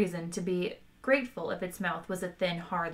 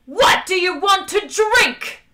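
very high, slow, full scream; enraged, drawn-out high shout; furious scream; 12 semitones higher, 12 dB louder, slower than usual. A narrator's reading. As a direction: scream the polite question like a threat. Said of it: "number two screamed".

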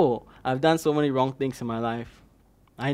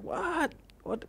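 shocked; 13 semitones higher, 9 dB quieter than usual. Retold or imitated. Reacting to God's words.

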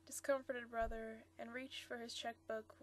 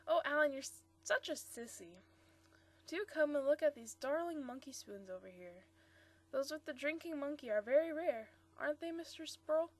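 lightly voiced playful young woman, a touch louder; Marcia's voice; 4 semitones higher, 6 dB louder than usual. Teasing her fiancé Bob, playful young woman. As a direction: tease him brightly about the funeral.

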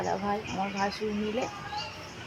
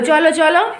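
loud, higher chivvying; 6 semitones higher, 10 dB louder than usual. Real, not acted. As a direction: hurry her along, loud. Mother hurries child to study.

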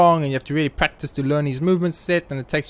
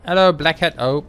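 ordinary talk

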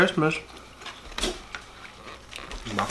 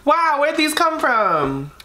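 high, slow, loud fake wonder; mock surprise; 6 dB louder, much slower than usual. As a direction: gasp it out like a big discovery. Mock surprise finding the drinks.

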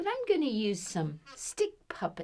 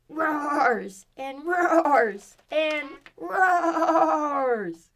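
very slow, high and loud, laughing; big drawn-out finish; 7 semitones higher, 7 dB louder, much slower than usual. A narrator's reading. Ending the story for kids, big laughing finish. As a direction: stretch each roar out joyfully.

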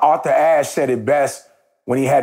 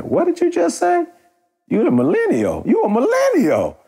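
high, incredulous squeak of shock; mock disbelief. Teasing the delivery woman, in high mock disbelief. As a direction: act floored by what she just said.

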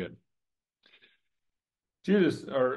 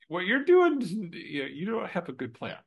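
mock disbelief, pitched way up on what you're doing; incredulous inner thought; 8 semitones higher, 4 dB louder than usual. Preacher voicing a friend's thoughts, incredulous and high. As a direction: scoff at a friend's bad plan, half joking. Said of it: "You would kinda be thinking".

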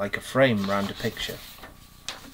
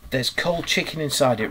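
ordinary delivery

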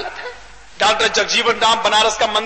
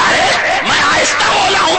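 exasperated shout; 9 semitones higher, 4 dB louder than usual. Acted. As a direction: bellow it in your own defence. Imam yells back in quarrel.

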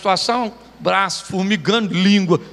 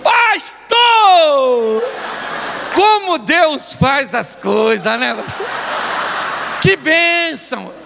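loud, drawn-out overjoyed greeting; hammed-up slow, high exclamations; mock gushing; 4 semitones higher, 4 dB louder, much slower than usual. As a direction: imitate an overexcited admirer calling out. Acted gushing man greeting the pastor.

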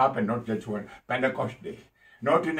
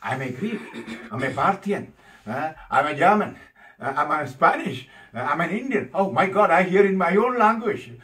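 raised pitch, growing louder into astonishment; amazed crowd; 5 semitones higher, 3 dB louder than usual. Acted. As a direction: voice astonished listeners, each higher, then exclaim. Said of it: "so they were amazed".

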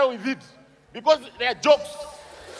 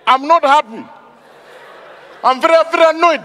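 bad fake anger; 4 semitones higher than usual.

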